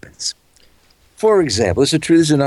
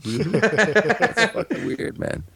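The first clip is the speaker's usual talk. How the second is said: laughing; 10 semitones higher, 5 dB quieter, much slower than usual. Real, not acted. Laughing at the joke.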